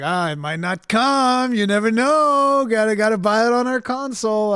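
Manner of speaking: exaggerated, coy mock sales pitch; mock corporate tease. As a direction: stretch the words high and loud, teasing. Mimics Sony teasing console buyers.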